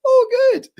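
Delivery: very high, loud squeaky "oh, good"; squeaky, strained mock delight; awkward fake cheer. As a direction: hide the awkwardness behind a squeak. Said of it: "I'm going".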